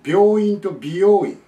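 loud, slow 病院 "byōin" against 美容院 "bi-yō-in"; contrasted similar words. Shown as a demonstration. Teacher contrasting two words, 病院 and 美容院 loudly spelt out by sound. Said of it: "全然違いますよね".

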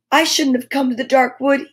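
ordinary delivery

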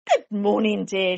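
loud, bright morning greeting; cheery wife. A narrator's reading. Missus Bear greeting her husband loudly and cheerfully. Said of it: "she said".

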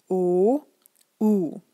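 ordinary talk, nothing marked

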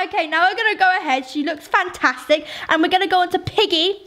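ordinary delivery

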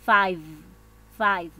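five with a clearly buzzed v at the end; buzzed final v. Shown as a demonstration. Teacher modelling five for students, buzzed v. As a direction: teeth on lip for the v in five. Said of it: "sobre tu labio inferior y soplas".

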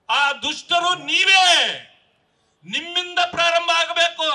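high, forceful rally shout; loud accusation. Real, not acted. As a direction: hurl the accusation at your political opponents. Rally speaker accuses his opponents.

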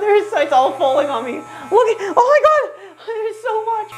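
high squeal through nervous laughter; giggly dismay. Real, not acted. Hair falling all over the speaker.

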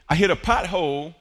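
ordinary talk, nothing marked

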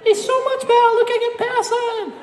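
high swooning fan voice. Pretend. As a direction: gush like a smitten fan. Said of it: "you're gonna say".

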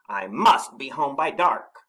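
ordinary delivery, nothing marked

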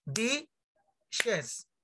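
DISHES slowly split in two syllables, di-shes; pronunciation demo. Shown as a demonstration. Teacher showing students the plural, "dishes" in slow syllables. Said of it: "La pronunciación es".

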